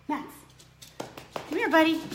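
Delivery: cooing at a pet. Real, not acted. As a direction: coax the puppy over sweetly. Calling the dog over.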